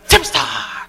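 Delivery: a hint of the SNL schoolgirl; mock superstar pose; Mary Catherine impression. Imitating the SNL schoolgirl to the audience. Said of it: "sniffed her armpits and went".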